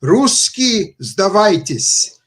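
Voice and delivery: put-on loud, high shout in a German accent through a megaphone; German accent, shouted surrender call. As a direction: call out the surrender demand in a heavy German accent like a soldier on a loudhailer. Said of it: "with an accent".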